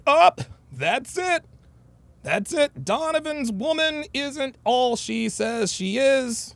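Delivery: slow, high-pitched mimicry of fault-finders; mock critics. Imagined listeners judging his story, mocking high drawn-out voice. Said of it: "a lot of people would listen to that story".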